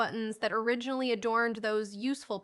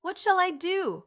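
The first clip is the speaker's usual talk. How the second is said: high, louder bewildered cry; distressed cry; 9 semitones higher, 5 dB louder than usual. A narrator's reading. Overwhelmed mother cries out, not knowing what to do. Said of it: "cried the poor woman".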